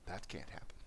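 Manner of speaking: hushed, scoffing disbelief; doubtful whisper. Retold or imitated. Listener scoffs at peace between conservatives and liberals, whispering. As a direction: whisper it like it's impossible. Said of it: "You go".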